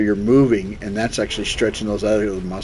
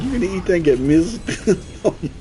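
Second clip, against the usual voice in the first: laughing while quoting; 8 semitones higher than usual. Retold or imitated. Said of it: "He said".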